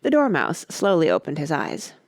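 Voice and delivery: usual speaking voice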